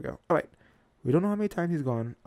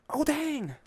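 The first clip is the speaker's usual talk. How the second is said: high, loud squirrel exclamation; Tippy Toe; 13 semitones higher, 6 dB louder than usual. Acted. Squirrel seeing something below, high startled exclamation. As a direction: cry it out high, taken aback. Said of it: "Tippy Toe says".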